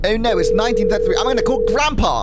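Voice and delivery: hammed-up English alarm; English accent. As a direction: rattle it off in flustered English. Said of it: "sorry, I'm English".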